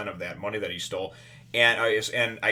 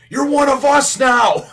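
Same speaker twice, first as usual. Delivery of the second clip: slow, loud, much higher triumphant scream; 14 dB louder, slower than usual. Retold or imitated. Luffy tells Nami she's crew, full scream. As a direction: yell it with all your lungs. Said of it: "screaming it at the top of his lungs".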